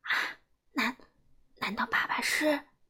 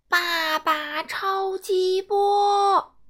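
attack call; 5 semitones higher, 5 dB louder, much slower than usual. Acted. Hero fires special attack.

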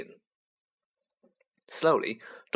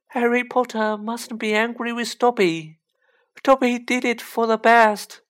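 high, pleading little elf; a fretful house-elf begging Harry; anxious house-elf voice; 10 semitones higher, 6 dB louder than usual. Acted.

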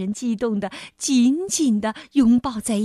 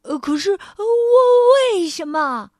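nervous halting king; stammering; 11 semitones higher, 10 dB louder than usual. A narrator's reading. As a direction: stumble over each word. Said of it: "国王结结巴巴地说". (结结巴巴)

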